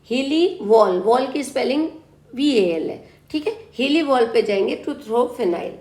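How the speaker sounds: ordinary talk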